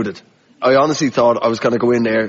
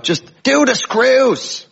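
higher, slow shouted heckle; heckler shout; 12 semitones higher, much slower than usual. Retold or imitated. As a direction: call it out from the back. Prisoner heckling from crowd.

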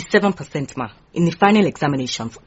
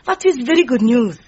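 high, loud squeal of joy; delighted squeal; 13 semitones higher, 3 dB louder than usual. Acted. Secretary to Ma Ramotswe on her engagement, delighted high squeal. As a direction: burst out with delight.

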